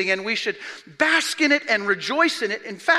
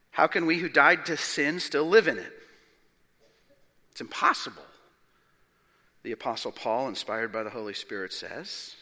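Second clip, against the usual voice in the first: ordinary talk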